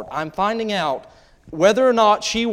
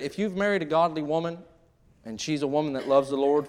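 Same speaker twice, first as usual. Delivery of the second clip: normal talking voice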